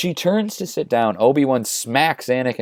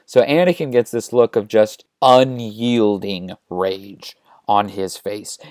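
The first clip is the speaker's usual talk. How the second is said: usual speaking voice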